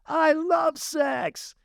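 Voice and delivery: slow, high, drawn-out yell of I love sex; slow high mock shout from the crowd; fan shouting. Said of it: "David Pierce goes".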